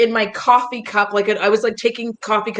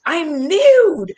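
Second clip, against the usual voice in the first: mock sultry put-on voice, pitched up; a light mock-seductive voice note voice; 6 semitones higher, 3 dB louder than usual. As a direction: tease with a silly put-on voice. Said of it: "the voice ones where I'm like".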